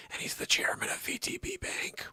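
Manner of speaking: quiet whispered reading. Real, not acted. Host whispers while reading the yacht owner's bio. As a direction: whisper the fact.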